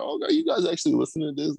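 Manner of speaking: high, amazed disbelief; surprised. Retold or imitated. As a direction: sound astonished they listen. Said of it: "It's like".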